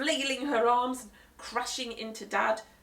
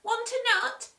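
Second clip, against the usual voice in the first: put-on higher, louder cheeky boy; Henry's voice; 6 dB louder than usual. Boy offering nuts to mum, cheeky high boy voice. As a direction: tease her with the nut.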